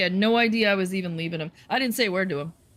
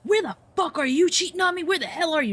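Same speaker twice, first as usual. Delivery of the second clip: higher, louder jealous yell; imitated screaming; 11 semitones higher, 3 dB louder than usual. Ex screaming jealous accusations on the phone, higher and louder. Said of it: "he's screaming".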